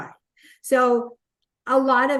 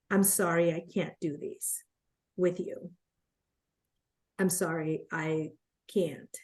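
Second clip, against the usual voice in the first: soft, much lower, fading to a whisper; quiet apology; 4 semitones lower, 9 dB quieter than usual. Retold or imitated. Partner on the phone backing out, soft and much lower. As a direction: hush it out like bad news you hate to give.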